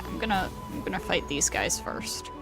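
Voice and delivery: ordinary delivery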